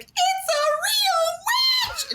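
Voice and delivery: very high, slow, laughing shriek; child screaming at a witch on Halloween; a scared child. Retold or imitated. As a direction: shriek it like a terrified little kid. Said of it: "she's like".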